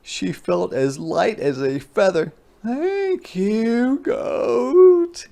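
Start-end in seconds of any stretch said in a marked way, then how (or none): 2.6-5.3 high, rising, loud squeaky thanks; overjoyed hedgehog thanks; grateful hedgehog. Acted. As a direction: sing it out in delight. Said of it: "she said".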